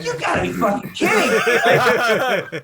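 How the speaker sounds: high, loud, half-laughing outburst of disbelief; exasperated shout. Retold or imitated. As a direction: yell it in mock outrage, laughing. Frustrated disbelief at screening feedback.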